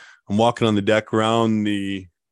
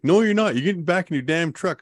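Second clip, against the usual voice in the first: scolding woman; 8 semitones higher than usual. Retold or imitated. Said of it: "She's like".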